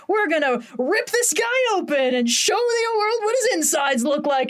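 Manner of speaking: hammed-up eager friends plotting; mock menacing friends. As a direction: announce the dissection with glee. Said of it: "his two new best friends being like".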